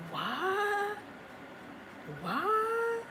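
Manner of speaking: high, breathless, incredulous what; as if you can't believe your ears; stunned disbelief. Real, not acted. Stunned by own people's rejection.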